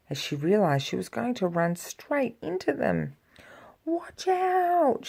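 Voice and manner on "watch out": warning cry. Acted. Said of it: "she cried".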